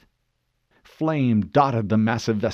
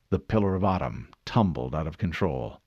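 plain reading voice

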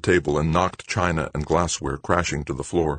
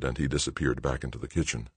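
ordinary delivery

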